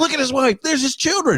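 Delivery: imagined tour guide. Pretend. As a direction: parade them like zoo exhibits. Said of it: "I fully expected him to go".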